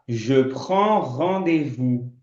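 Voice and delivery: slow, careful French phrase; slow French demo. Shown as a demonstration. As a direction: spell it out in sound for learners. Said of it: "Eu vou falar". (francês lento e cuidadoso)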